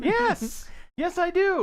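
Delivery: high mock insistence. Mock defensive reply.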